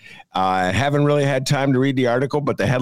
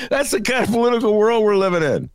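laughing; 12 semitones higher than usual. Real, not acted. Laughing joke.

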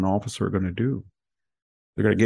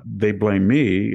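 ordinary delivery

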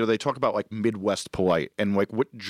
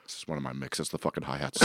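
much softer, low grumble to himself; muttered aside; 7 semitones lower, 11 dB quieter than usual. Retold or imitated. On a date, grumbling about his own mix, muttered. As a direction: mutter it under your breath, distracted.

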